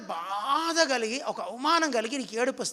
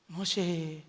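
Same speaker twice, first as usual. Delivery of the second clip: soft divine call; 6 semitones lower, 6 dB quieter, much slower than usual. Acted. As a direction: drop low and soft on the last call. God calls Moses from the bush. ("మోషే" అని మెల్లగా, తగ్గిన గొంతుతో పిలుస్తూ)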